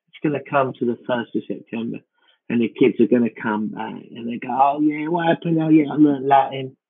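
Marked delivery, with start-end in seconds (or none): 4.5-6.8 put-on kid voice. Imitating returning school kids.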